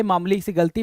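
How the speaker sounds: normal talking voice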